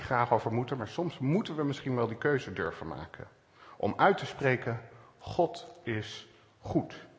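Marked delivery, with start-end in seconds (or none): 5.2-7.1 slow, softer, word by word; deliberate declaration. Real, not acted. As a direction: declare it slowly with pauses between the words. Pauzerende uitgesproken geloofsbelijdenis. (langzaam, woord voor woord uitgesproken)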